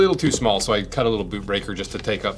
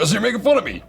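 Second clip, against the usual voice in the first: high and loud, hammed-up indignation; mock outraged surprise; 11 semitones higher, 5 dB louder, much faster than usual. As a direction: play it as a big fake gasp of offense. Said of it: "I'm like".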